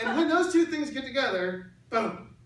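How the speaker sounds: put-on a boy proudly revealing the big secret; excited kid. Son explains conception, "boom.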